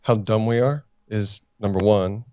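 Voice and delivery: normal talking voice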